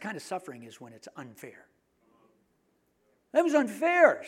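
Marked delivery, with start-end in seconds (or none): 3.3-4.2 higher, louder whining protest; whiny complaint. Retold or imitated. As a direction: whine it like a wronged wife. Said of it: "says James's wife".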